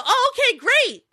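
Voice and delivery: very high, chirpy reply; perky pretend voice. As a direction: chirp it as if playing for a child. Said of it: "And then I go".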